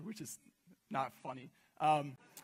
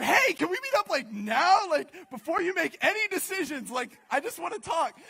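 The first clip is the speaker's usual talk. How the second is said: high, loud and pressing; urgent plea; 9 semitones higher, 8 dB louder than usual. Retold or imitated. Calling her the next morning, loud urgent plea. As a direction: beg her to meet right now.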